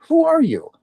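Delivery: high, loud burst of bafflement; startled question. Retold or imitated. Storyteller reliving questioning the mysterious stranger, startled. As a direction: demand it, pitch leaping way up.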